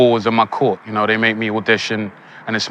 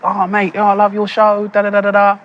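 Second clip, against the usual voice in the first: high, louder mock Beckham voice; imitating Beckham; 10 semitones higher, 4 dB louder than usual. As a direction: put on David Beckham's chatty footballer voice. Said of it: "He was like".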